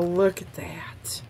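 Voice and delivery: hushed whisper of awe; whispering in wonder at a card. Real, not acted. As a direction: breathe it out as a whisper. Admiring the last card closely.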